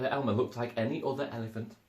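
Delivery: ordinary delivery, nothing marked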